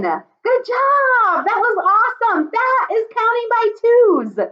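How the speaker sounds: high, delighted praise. Real, not acted. Teacher praises kids' counting in a high excited voice. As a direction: cheer them on, way up high.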